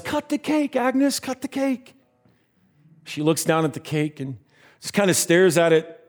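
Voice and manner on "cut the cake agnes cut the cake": high, held-back cheering chant; mock crowd yell. Party guests urge Agnes to cut the cake, mock yelling. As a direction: imitate a yelling crowd without real volume, pitched high. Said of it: "everyone cheers and yells".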